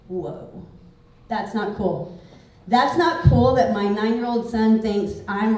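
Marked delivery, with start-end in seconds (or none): 0.1-2.2 low, hushed retold reaction; taken aback. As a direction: drop to a soft, low, stunned voice. Recalls her stunned reply to son.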